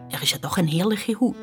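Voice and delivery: ordinary delivery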